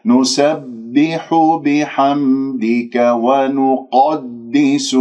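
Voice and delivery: نسبح drawn out, ب doubled; slow tajweed recitation. Shown as a demonstration. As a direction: recite نسبح بحمدك slowly. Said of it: "لاحظوا كيف أنه النونات".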